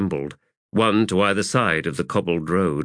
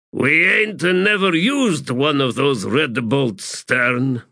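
higher, slow character voice; doubtful sapper; 7 semitones higher, 4 dB louder, slower than usual. Acted. Sapper warning corporal about red bolts, doubtful. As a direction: raise the objection, drawing words out.

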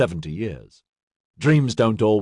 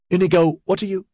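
high, urgent and cut off; alarmed question; 9 semitones higher, 3 dB louder, faster than usual. A narrator's reading. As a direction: call out in sudden alarm. Alarmed woman cut off mid-question.

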